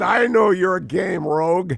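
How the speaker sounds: high, loud, drawn-out Scottish accent. Shown as a demonstration. Host demonstrating his stock film line, amateur Scottish accent. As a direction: declaim it in a slow stage Scottish accent. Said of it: "That's what most of my Scottish accent is".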